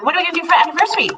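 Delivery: eager rush. Retold or imitated. Quoting herself, eager.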